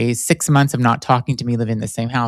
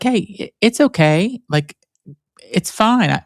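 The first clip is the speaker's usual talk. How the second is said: a higher, lightly voiced dad quote, reassuring; reassuring father; 6 semitones higher than usual. Father to his son who just came out, higher reassuring voice. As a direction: imitate the dad calmly saying it's fine.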